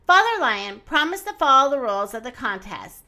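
ordinary delivery

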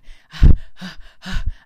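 loud panicky huffing; panicked panting. Retold or imitated. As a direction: huff and puff in mock panic. Acting out rush for train.

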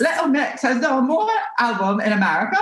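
put-on high-pitched classical British accent; Jessie's high British accent. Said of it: "she's kinda talks, like, up here".

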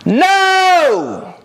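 very high, loud drawn-out "No!"; mock collective yell. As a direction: yell it as the whole audience would, hammed-up. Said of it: "Everybody listening to this just yelled".

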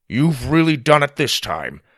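loud scolding grandfather; stern. Acted. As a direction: scold them sternly and loudly. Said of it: "he said with a stern look".

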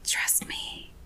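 hushed, intimate mock whisper; imitated whisper. Eric leaning in close to Sookie, whispered.

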